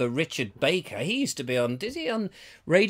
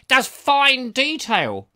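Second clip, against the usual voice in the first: slow, higher, louder friend's voice; imitating Martin; 7 semitones higher, 5 dB louder, much slower than usual. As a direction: draw out his boast about the printer. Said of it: "'cause he talks like that".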